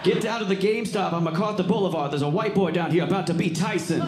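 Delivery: summoning the cousin, high and frantic; excited phone call. Retold or imitated. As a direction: yell it into the phone to hurry him. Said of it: "He goes, calls his cousin".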